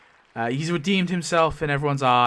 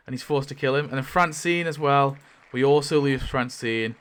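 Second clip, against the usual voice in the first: normal talking voice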